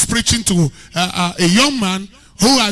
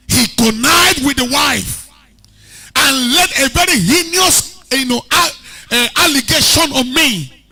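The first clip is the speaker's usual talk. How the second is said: aggrieved servant shouting; 7 semitones higher, 5 dB louder than usual. Retold or imitated. Preacher voicing the wronged servant, loud and high. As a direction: cry out the betrayal at full preaching force. Servant's grievance retold at full force.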